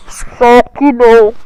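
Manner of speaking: very loud, slow, stretched; sarcastic shout. Real, not acted. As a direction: belt out the ironic correction. Retracts easy boast.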